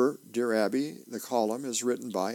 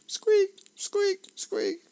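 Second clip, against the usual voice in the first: short, very high squeaks; squeaking chair; 5 dB louder, much slower than usual. Retold or imitated. Narrator imitating the chair as the sergeant shifts, high squeaks. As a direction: mimic a chair squeaking three times.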